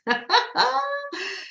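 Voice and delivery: high-pitched giggle; real laugh. Real, not acted. Amused while checking the stream.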